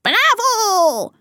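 very high, drawn-out squeal; cheering monkey. Acted. Monkey won over by the elephants, squealed cheer. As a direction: call it out high and long. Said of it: "ruft der Affe".

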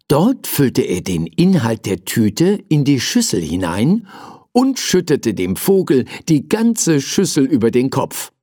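plain reading voice

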